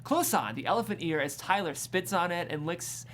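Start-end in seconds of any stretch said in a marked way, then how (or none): none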